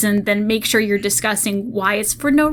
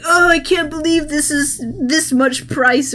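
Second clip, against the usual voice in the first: lightly hammed-up, higher and drawn-out disbelief; put-on complaint in a raised voice; mock outraged reaction; 5 semitones higher, slower than usual. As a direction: imitate an appalled fan, slowly. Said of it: "everyone's reactions".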